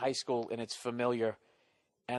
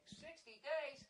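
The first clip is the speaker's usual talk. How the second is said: much higher, soft incredulous echo; mock surprised; 16 dB quieter, slower than usual. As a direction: play the amazed listener. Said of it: "That's right".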